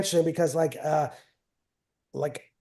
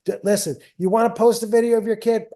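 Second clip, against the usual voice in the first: eager; 6 semitones higher, 5 dB louder than usual. Real, not acted. Eager reply to parent's joke.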